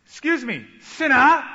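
loud, high, sneering call; a hammed-up sneer at the sinner; mock accusing. A pushy evangelist accosts a stranger, loud and sneering. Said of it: "He doesn't say".

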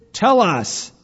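loud, high, insistent order; demanding. A narrator's reading. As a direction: demand an answer loudly. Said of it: "demanded a third".

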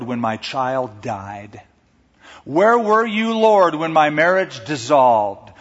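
a building lament to God; grieving lament. Retold or imitated. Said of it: "That's the honest struggle".

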